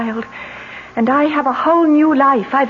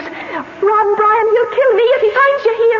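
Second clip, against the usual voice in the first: high, panicked warning; frightened urgency; 6 semitones higher than usual. Acted. Wife begs lover to run, frightened. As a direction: urge him away in fear.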